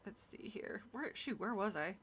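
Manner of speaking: unvoiced whisper to herself. Real, not acted. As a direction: whisper while finding the place.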